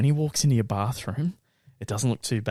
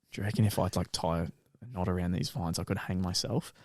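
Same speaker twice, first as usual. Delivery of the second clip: soft, spaced-out murmur; dazed murmur; 5 semitones lower, 9 dB quieter than usual. Retold or imitated. Stoned friend asks about the vines, dazed and soft. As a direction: ask it soft and far away, a bit lost.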